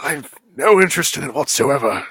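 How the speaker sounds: hammy fake British accent; lightly put-on British voice; bad British accent. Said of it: "That, that's a bad British accent".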